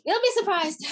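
laughing out the words; half-laughing reply. Real, not acted. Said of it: "laughing through the reply".

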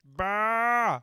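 loud bah; sound check shout. Pretend. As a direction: bark it out loud.

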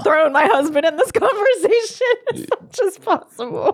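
high voice bubbling with laughter; laughing retelling. Retold or imitated. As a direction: giggle through the embarrassed confession. Laughing through her embarrassed retelling.

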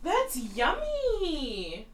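high, very drawn-out exclamation; delighted sing-song. Real, not acted. Repeating her verdict on the drink, delighted.

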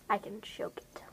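a menacing whisper to herself. Acted. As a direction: breathe the line, no voice. Repeats the line, then whispers.

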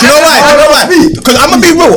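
laughing as he says it. Real, not acted. Laughing, higher.

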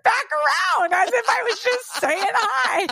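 very high, slowed, squealing with laughter; laughing squeal. Real, not acted. Recounting how she just turned back around, squealing laughter. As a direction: tell it while cracking up, voice shooting high. Cracking up while telling the story.

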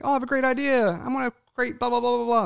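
high mock-excited dreamer voice; mock-bright boastful idea guy; mocking lazy dreamer. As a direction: parody someone all talk, no action.

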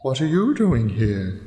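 slow, drawn-out owl voice; put-on owl talk, slow and hooty; hooting owl. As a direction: stretch the words like soft hoots. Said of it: "It softly hoots".

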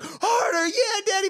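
very high mock shriek; hammed-up woman's cry. Woman egging the boxer on, hammed-up high cry. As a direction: imitate her urging in a high squeal. Acting the woman crying out for more.

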